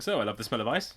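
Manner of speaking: quiet flat imitation of friend; deadpan copy of friend's words; mimicking flatly. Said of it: "I love how you just repeat it".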